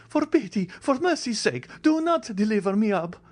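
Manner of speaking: high, begging cry; hunted man pleading, pitched high; desperate plea. A narrator's reading. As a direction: clasp hands and beg to be saved. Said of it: "clasping his hands".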